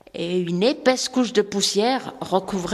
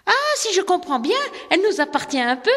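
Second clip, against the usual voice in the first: higher, quick, hopeful; eager question; 10 semitones higher than usual. Acted. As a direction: ask eagerly, a little faster. Rosemary asks father, eager. (fillette qui demande à son père, pleine d'espoir)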